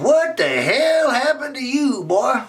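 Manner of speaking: high, slow startled friend voice; Jerry's high voice. Acted. Friend Jerry shocked at Raymond's face, high and drawn out. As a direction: pitch way up, stretch out what the hell.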